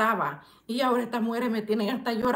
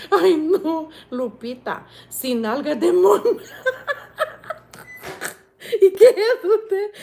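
laughing; 6 semitones higher, 8 dB louder than usual. Real, not acted. Laughing at viewer's joke.

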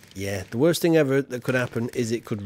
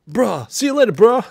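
louder, higher American take on bruh; second, louder American bruh; American accent bruh; 8 semitones higher, 9 dB louder than usual. Pretend. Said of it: "American accent for Guy Fawkes".

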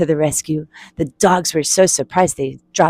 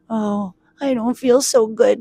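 higher, feeble storybook complaint; woozy little lament; unwell character voice; 4 semitones higher than usual. Acted. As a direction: groan it like an unraveled mitten. Unraveled Mitten feels sick.

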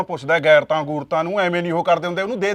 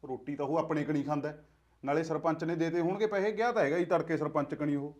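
much softer, hushed bickering at night; hushed quarrel; 8 dB quieter than usual. Acted. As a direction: keep the fight down so the child sleeps. ਆ ਕੁੜੀ ਸੁੱਤੀ ਪਈ ਆ.

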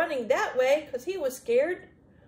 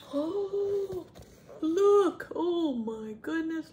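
high, drawn-out gasp of wonder; high-pitched storytime amazement; astonished; 5 semitones higher, 3 dB louder, much slower than usual. Acted. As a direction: marvel at it for little listeners. Astonished at picture for children.

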